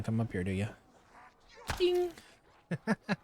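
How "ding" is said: high ding sound. Pretend. Imitates a game sound.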